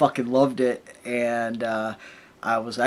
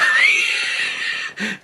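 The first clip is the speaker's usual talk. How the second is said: shrieking laugh. Real, not acted. Laughing at drunk guy story.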